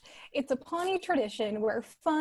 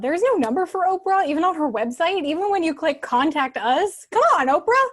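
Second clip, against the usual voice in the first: louder, rising exasperation; exasperated; 3 semitones higher, 7 dB louder than usual. Acted. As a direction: vent your frustration. Frustrated rant about Oprah.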